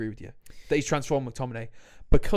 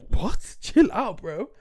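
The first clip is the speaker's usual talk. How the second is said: mock manager; 7 semitones higher, 4 dB louder, much slower than usual. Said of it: "He's like".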